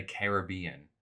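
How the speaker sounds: CARIBBEAN with the stress on BE-an, "care-uh-BEE-un"; odd pronunciation. Real, not acted. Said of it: "That's how you pronounce it?".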